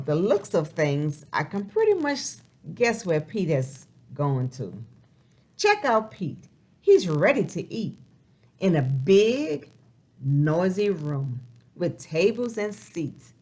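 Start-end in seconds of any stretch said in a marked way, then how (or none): none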